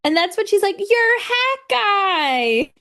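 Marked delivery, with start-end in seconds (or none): 0.8-2.4 high, drawn-out realization; dawning recognition. Retold or imitated. Hypatia to her would-be kidnapper, dawning recognition. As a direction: stretch it out high as it clicks.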